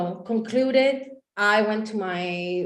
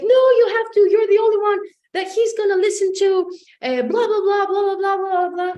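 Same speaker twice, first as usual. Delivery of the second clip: high, loud imitated nagging; insistent friend; 11 semitones higher, 7 dB louder than usual. Friend pressing her to help someone, loud insistent imitation. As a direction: pester loudly, running on into blah-blah.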